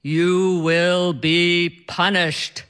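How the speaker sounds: slow, solemn mock-ominous pronouncement; mock godlike voice. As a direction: summon the powers of the universe, teasing. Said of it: "he says in this deep, godlike voice".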